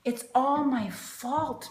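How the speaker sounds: ordinary delivery, nothing marked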